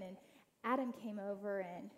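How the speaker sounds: ordinary delivery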